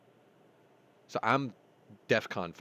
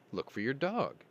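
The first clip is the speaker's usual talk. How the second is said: ordinary talk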